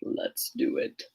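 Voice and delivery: very low whispered rumble; deep hushed storyteller growl; that reading voice. Shown as a demonstration. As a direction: drop way down and breathe the words out. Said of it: "I gotta do that voice again".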